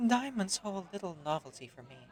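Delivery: a tired woman, barely bothering; soft sleepy voice. Acted. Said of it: "Her voice is soft and sleepy".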